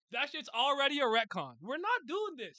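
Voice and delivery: high, loud protest; indignant outburst. Real, not acted. Streamer rejecting the retcon to chat, indignant shout. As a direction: refuse it outright, voice shooting up.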